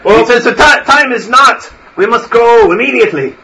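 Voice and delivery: put-on an old priest rushing them out; emphatic old priest. Priest insists.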